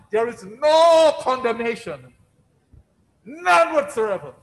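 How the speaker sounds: fervent preaching. Acted. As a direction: preach it out with rising conviction. Preaching at full force.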